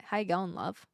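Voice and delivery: lazy, low-effort Aussie greeting; lazy Aussie accent. Shown as a demonstration. Streamer testing a looser take, lazy Aussie accent. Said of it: "if I'm lazy with it".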